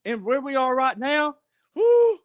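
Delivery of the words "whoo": high loud holler. Retold or imitated. Storyteller hollering whoo like a country comedian.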